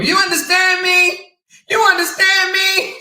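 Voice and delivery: high mocking shout. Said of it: "in B P voice".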